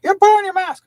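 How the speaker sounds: high, loud screech of an order; mock yelling. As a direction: yell it like an irate store manager. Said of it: "yelling at me".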